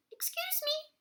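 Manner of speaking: very high squeaky polite voice; tiny toad voice. Acted. Tree Toad interrupting the animals, very high squeaky voice.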